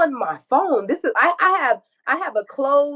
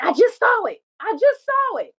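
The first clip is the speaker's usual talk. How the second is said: high-pitched retold insistence; mock indignant outburst; imitated insistent accusation; 11 semitones higher than usual. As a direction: act out him insisting, pitch jumping high. Retells his insistent accusation.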